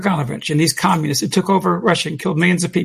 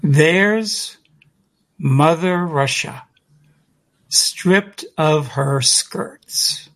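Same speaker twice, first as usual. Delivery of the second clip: very slowly, word by word, with long pauses; slow and emphatic; much slower than usual. Retold or imitated. Speaker quoting Kaganovich's words to listeners, slow and emphatic. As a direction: let each phrase hang, slow and deliberate. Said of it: "say it real slowly and emphatically".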